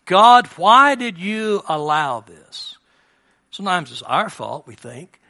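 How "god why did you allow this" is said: anguished plea. Retold or imitated. Voicing anguished cry to God.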